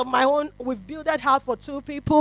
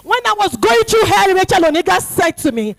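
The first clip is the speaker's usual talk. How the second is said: loud, raised testimony; preaching; 3 semitones higher, 5 dB louder than usual. Acted. Preacher testifying about her godmother's burial, loud. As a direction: declare it boldly.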